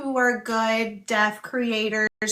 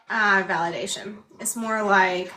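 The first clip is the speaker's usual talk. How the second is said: normal talking voice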